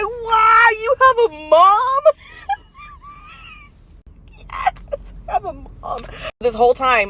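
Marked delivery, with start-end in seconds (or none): 0.0-2.0 much higher, louder amazed cry; astonished kid. Retold or imitated. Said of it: "He must have flipped backwards in his chair". 2.1-6.0 crying laughing. Real, not acted. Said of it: "laughing so hard that I'm freaking crying".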